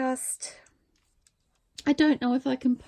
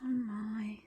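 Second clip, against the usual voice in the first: awed hushed whisper; slow unvoiced wonder; 3 semitones lower, 7 dB quieter, much slower than usual. Real, not acted. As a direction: breathe it, barely voiced. Awed at gift fabric.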